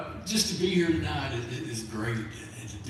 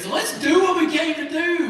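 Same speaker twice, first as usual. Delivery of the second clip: loud, high call to the crowd; rallying; 12 semitones higher, 7 dB louder than usual. Real, not acted. Host urging the crowd to have fun, loud rallying shout. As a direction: pump the room up.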